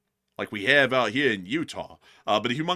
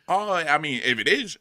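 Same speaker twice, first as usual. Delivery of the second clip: ordinary delivery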